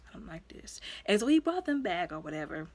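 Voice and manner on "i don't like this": whispered unease. Retold or imitated. Her own uneasy reaction to the father.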